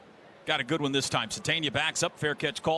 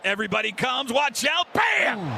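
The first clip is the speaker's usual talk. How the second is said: loud, higher shout into the hit; excited shout; 6 semitones higher, 4 dB louder than usual. Real, not acted. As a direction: brace the crowd, then yell out the hit. Big hit called live.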